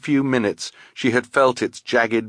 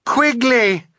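high, loud scream to a friend swept away; desperate high call; screamed name; 14 semitones higher, 5 dB louder than usual. A narrator's reading. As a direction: scream the name over rushing water. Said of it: "Violet screamed again".

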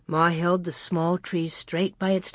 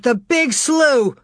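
slow, high exclamation of dread; alarmed exclamation; 6 semitones higher, 4 dB louder, slower than usual. A narrator's reading. Pa realizing where Grace may be, alarmed. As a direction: exclaim it slowly, horror dawning. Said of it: "he exclaimed".

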